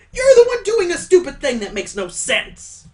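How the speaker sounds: high, loud indignant yell; indignant outburst. Acted. Game character snapping back at an accusation, indignant yell.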